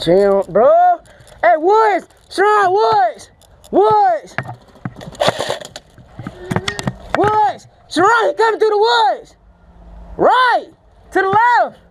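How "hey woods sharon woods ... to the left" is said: urgent calls to teammates; calling out. Real, not acted. Player warning teammates someone's coming through the woods, calling out. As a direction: call out enemy positions across a field.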